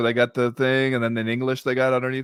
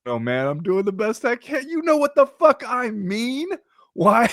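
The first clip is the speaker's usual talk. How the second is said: helpless tourist; 12 semitones higher, 3 dB louder than usual. Retold or imitated. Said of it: "And I was just like".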